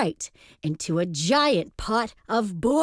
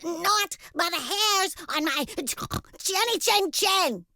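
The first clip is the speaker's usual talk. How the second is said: high-pitched, defiant little pig; piggy yell; 9 semitones higher than usual. A narrator's reading. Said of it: "yelled Horace".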